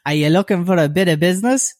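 loud, high imitation of a street solicitation; prostitute's come-on. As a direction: pitch it up and tout for custom. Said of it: "What was it she said".

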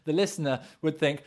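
ordinary talk

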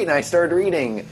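ordinary delivery

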